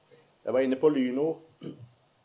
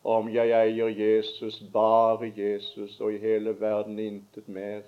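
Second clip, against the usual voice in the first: prayer; 4 semitones lower than usual. Acted. Closing prayer.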